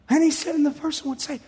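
normal talking voice

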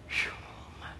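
whispered sigh. Retold or imitated. Reacting to doctor's lecture.

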